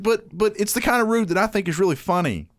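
high, earnest mock kid voice; explaining nephew.

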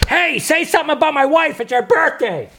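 pushy fan. Retold or imitated. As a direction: barge in like a pushy backstage fan. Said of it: "and you have someone".

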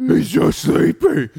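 loud, slow parody superhero voice; Batman. Retold or imitated. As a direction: stretch it like a cartoon vigilante. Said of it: "I like the Bat Metal voice".

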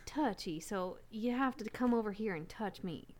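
low, coy Southern come-on; Southern accent. Acted. Costumed guest inviting someone closer, low Southern accent. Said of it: "I'm just trying to get into character".

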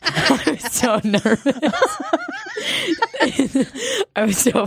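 laughing through the words. Real, not acted. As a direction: giggle as you say it. Laughing while telling.

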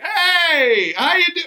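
high-pitched, over-the-top come-on; exaggerated flirty greeting. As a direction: ham up a smooth hello, way up high. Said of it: "I didn't sound like this".

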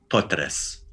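Potres, rolled r, soft s at the end; Croatian pronunciation. Shown as a demonstration. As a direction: repeat Potres slowly and clearly. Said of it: "one more time".

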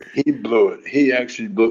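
ordinary talk, nothing marked

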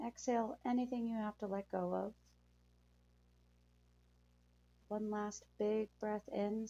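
calm soothing guide through breathing; guided meditation. Acted.

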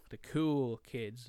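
usual speaking voice

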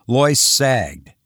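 usual speaking voice

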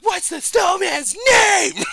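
high, loud, drawn-out yell; hammed-up exasperated shout. Imitating dad giving Frosty clues, exasperated yell. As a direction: let it burst out slowly, imitating a frustrated dad. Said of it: "fucking let out one of those".

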